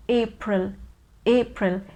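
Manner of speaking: April said as "AY-pril", in two syllables; correct April. Shown as a demonstration. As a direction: say April clearly, starting with a long A. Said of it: "First one is A and the second one is pril".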